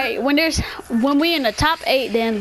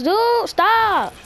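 annoyed yell; 8 semitones higher than usual. Real, not acted. Kids arguing during game.

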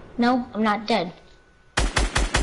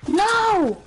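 dismayed yell; 11 semitones higher than usual. Real, not acted. Dies again in game.

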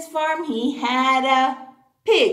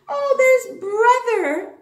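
delighted; 9 semitones higher, 9 dB louder than usual. Acted. Reveal of picture.

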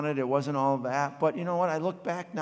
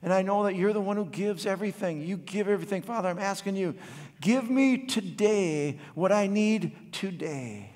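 earnest spoken prayer, slowing on the last plea; lightly voiced opening prayer; 4 semitones higher than usual. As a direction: pray it sincerely, easing down at the end.

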